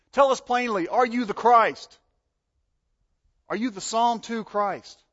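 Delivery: loud, high, demanding challenge; demanding shout. Acted. Accusers confronting Jesus, loud demanding shout. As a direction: hurl the question at him. Voicing rulers questioning Jesus.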